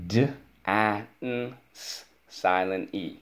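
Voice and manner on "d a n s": D-A-N-S as separate letter sounds; sounding out. Shown as a demonstration. Teacher models spelling dance for pupil, sounding out. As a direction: isolate each sound in D-A-N-S. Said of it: "Let's go".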